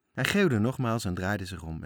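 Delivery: ordinary delivery, nothing marked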